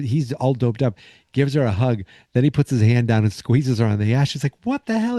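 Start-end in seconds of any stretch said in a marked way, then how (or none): 4.6-5.2 high-pitched indignant reaction; mock outrage. Laurie grabbed by Artie during a hug, mock outrage. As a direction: imitate a shocked woman, pitch jumping up.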